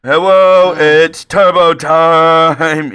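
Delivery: high, loud, slow dopey shout; Turbo Nick voice. Acted. Host relaunches the Turbo Nick voice, loud and drawn out. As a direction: holler it drawn out like an overexcited oaf. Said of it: "It's just a voice".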